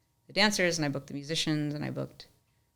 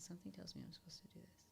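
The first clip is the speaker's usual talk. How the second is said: hushed whisper; 3 semitones higher, 25 dB quieter, faster than usual. Retold or imitated. Donor's words retold.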